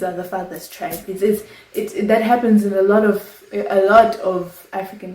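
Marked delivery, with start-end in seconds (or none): none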